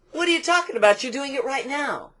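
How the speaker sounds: high-pitched, incredulous retort; challenging trainer. Retold or imitated. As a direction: imitate a trainer calling someone out. Trainer challenges her, retold.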